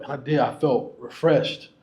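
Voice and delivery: usual speaking voice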